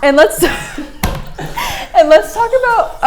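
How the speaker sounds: words squeezed out through high giggles; laughing. Real, not acted. Student cracking up explaining her mascot pick, laughing. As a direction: laugh it out mid-sentence.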